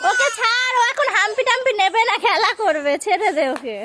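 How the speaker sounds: high coaxing. Real, not acted. Telling older child to let go.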